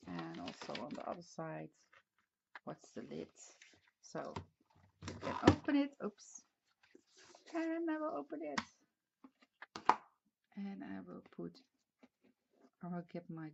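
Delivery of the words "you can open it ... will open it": excited. Real, not acted. Excited showing the lid.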